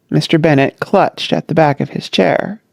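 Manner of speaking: plain reading voice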